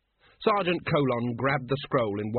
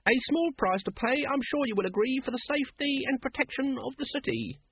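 very high monotone recital; stiff, stilted high voice; wooden; 13 semitones higher than usual. A narrator's reading. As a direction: parrot the official line on one high note. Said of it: "said his assistant woodenly".

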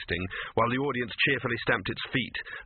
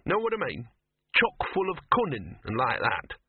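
higher-pitched streetwise mutt; ranting dog; 3 semitones higher than usual. Acted. Dog lecturing Victor on wolf ancestry, indignant. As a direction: hold forth as a scruffy, indignant dog. Voices Gaspode ranting on wolves.

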